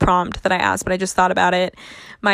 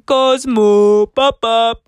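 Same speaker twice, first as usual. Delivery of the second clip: loud imitated Scottish call; playful, booming Scottish impression; mock Scottish cat-call; 2 semitones higher, 7 dB louder, much slower than usual. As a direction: belt out the cat's name in mock Scottish. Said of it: "walk around the house saying".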